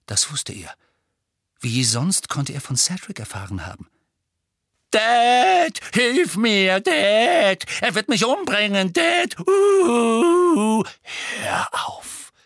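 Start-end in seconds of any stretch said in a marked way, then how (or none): 4.9-10.8 loud, high wailing plea; shrieking cry-baby imitation; mocking whimper. A narrator's reading. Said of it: "nahm eine spitze, wimmernde Stimme an". 11.4-12.3 whispered plea to stop; hushed, voiceless warning; quiet. A narrator's reading. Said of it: "sagte Harry leise".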